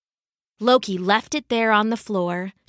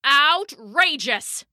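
higher, drawn-out cry of outrage; bellow; 5 semitones higher, slower than usual. Acted. Thor on hearing of the starving humans, slow bellow. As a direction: bellow it slowly, stretching the word. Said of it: "bellowed Thor".

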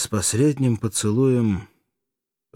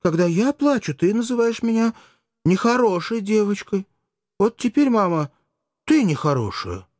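put-on high, sulky child voice; a small girl telling her mother off; high childish reproach, growing louder on "Вот теперь"; little girl's voice; 12 semitones higher, 4 dB louder than usual. A narrator's reading. Little daughter scolds crying mother.

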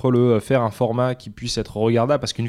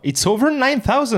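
high-pitched, drawn-out mock battle cry; mock meme shout; 12 semitones higher than usual. Player hamming up a meme over the score, mock shout. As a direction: call it out high and slow, hammed-up.

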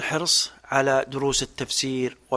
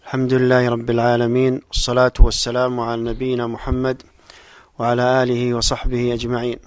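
solemn opening invocation; formal standard arabic; 5 dB louder than usual. Acted. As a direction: intone the opening blessing.

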